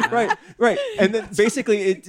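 high laughing giggle. Real, not acted. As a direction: laugh through the words. Laughing hard.

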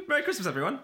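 laughing merry greeting; giggly and bright. Real, not acted.

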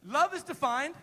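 call and response lead. Shown as a demonstration. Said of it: "I want to say that with me".

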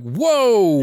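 a high, loud shocked whoa. Retold or imitated. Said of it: "I'm like".

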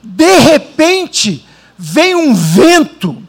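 drawn out, heavy scripture reading; slow dramatic reading. Acted. Preacher reading Acts to the congregation, slow and dramatic. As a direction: stretch each word like a revelation.

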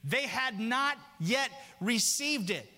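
high, ringing, drawn-out preaching; preaching at full force. Acted. Preacher building to a climax about rivers, fervent and high. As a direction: lift the church with rising fervor.

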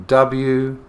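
slow, letter by letter; spelling it out. Shown as a demonstration. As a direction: spell each letter out slowly. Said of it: "We spell woof".

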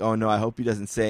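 ordinary talk, nothing marked

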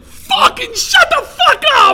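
very high, drawn-out screech; hammed-up ecstatic shriek; retold amazed scream. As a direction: scream it slowly in over-the-top disbelief. Retold amazed reaction to being recognized.

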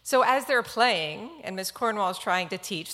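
usual speaking voice

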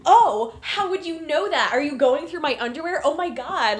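high, loud acted-out alarm; mock shocked indignation. Rick asking Vivian about the underwear, acted shocked outburst. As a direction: play him scandalised and flustered. Acts out Rick's shocked reply.

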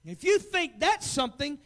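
loud, high fiery sermon warning; preaching at full force. Acted. Preacher warns his church, loud and high. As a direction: hammer the warning home, preacher style.

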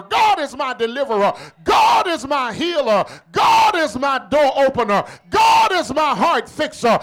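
rhythmic, punched sermon delivery; rally the congregation with each phrase; fervent preaching. Real, not acted. Preaching at full force.

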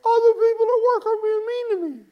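high, whiny mocking voice of a hurt churchgoer; mock whiny complainer. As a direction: whine it like a spoiled kid complaining. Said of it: "you're going".

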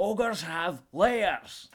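loud, very slow Scottish ogre; hammed-up ogre proclamation; Shrek. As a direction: boom it out, drawing out every word. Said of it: "Oh, there's Shrek".

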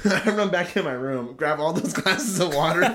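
high-pitched, laughing storytelling; cracking up mid-story. Real, not acted. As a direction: tell it through real giggles, pitch rising. Laughing while telling the absurd fix.

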